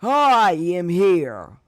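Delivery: big, slow heroic shout; loud voice. Acted. All Might arriving behind the sludge villain, loud drawn-out announcement. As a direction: boom it out high and drawn out like a hero's entrance. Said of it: "A loud voice came behind".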